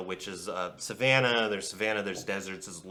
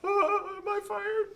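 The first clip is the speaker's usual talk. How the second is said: high squeaky anxious little servant voice; nervous servant; 7 dB louder than usual. Acted. Servant insists to the king he is calm, high nervous voice.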